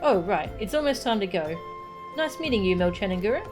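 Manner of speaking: put-on bouncy child voice with swooping pitch; inquisitive child. Kaharu found hiding by a stranger, inquisitive child. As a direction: play a chatty, curious kid.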